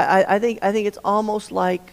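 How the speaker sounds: usual speaking voice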